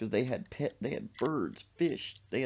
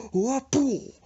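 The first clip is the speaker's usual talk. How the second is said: high, loud whooping smack; fish-slap sound effect; 14 semitones higher, 8 dB louder than usual. Retold or imitated. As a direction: whoop out the blow. Imitated blow with a fish.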